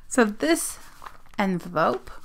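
ordinary delivery

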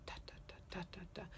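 soft "da" bouncing after a first impulse. Shown as a demonstration. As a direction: let "da" bounce lightly like a ball. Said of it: "almost like a bouncing ball".

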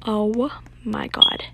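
ordinary talk

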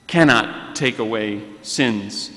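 usual speaking voice

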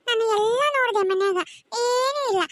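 high, plaintive report to mother; tearful complaint. Acted. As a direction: complain to mother, near tears. Child tells mother there's no food. (ಅಳು ದನಿಯ ದೂರು)

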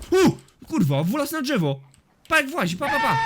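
high, loud amazed outburst; excited alarm. Real, not acted. Gamer watching his attacker climb a tree, loud excited yelps. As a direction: yelp it in high-pitched astonishment.

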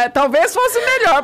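high, loud, slow retort through laughter; laughing mock retort. Journalist retelling her comeback to the guest, laughing retort. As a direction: drag it out loudly, cracking up.

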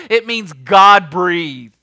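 slow, loud, God-breathed drawn out; weighty emphasis. Acted. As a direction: let the word land. Preacher stresses key meaning.

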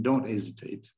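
ordinary talk

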